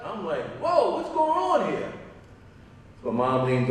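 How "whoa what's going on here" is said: high, slow startled question; startled whoa. Retold or imitated. Dad startled by his cursing son, high slow surprise. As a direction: caught off guard, pitched way up and drawn out.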